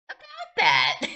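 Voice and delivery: surprised outburst. Acted. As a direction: blurt it out in shock. Sudden high outburst.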